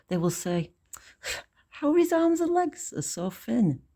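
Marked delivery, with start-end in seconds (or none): 1.7-3.8 high-pitched voice of critical onlookers; gossiping onlookers. Acted. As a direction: put on a high voice to gossip about him. Mocking onlookers quoted in poem.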